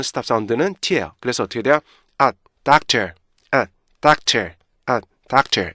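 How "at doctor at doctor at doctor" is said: "at, doctor" cut apart by a T stop; split with T stop. Shown as a demonstration. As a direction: break between "at" and "doctor". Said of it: "이렇게 발음돼요".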